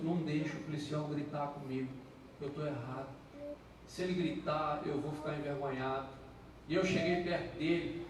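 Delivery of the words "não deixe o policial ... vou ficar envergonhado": quiet prayer. Retold or imitated. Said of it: "Aí eu comecei a orar".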